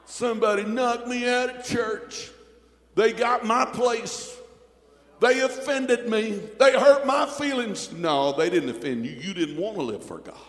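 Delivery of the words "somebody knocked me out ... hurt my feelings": high whiny mocking voice; pouting backslider. Preacher mocking pouting backsliders, high whiny whine. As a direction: mimic a sulking churchgoer. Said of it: "backsliders home today sitting pouting".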